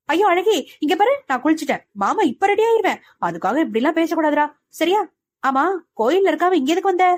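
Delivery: high, fast, sheepish coaxing; guilty pleading. A narrator's reading. Mano coaxes his angry bride, high and sheepish. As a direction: sweet-talk her out of calling it off. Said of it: "திருட்டு மொழி முழித்துக் கொண்டு".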